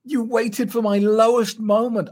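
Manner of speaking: high, slow, amazed mock praise; shocked admiration. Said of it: "I was shocked".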